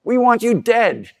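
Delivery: shrill with wounded pride; outraged Pharisees. Retold or imitated. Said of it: "the scribes and Pharisees that say".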